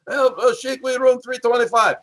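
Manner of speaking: high put-on wrestler voice; Nikolai. Wrestler tells his roommate the room, imitated high voice. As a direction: answer in a high-pitched caricature. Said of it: "Sheik said".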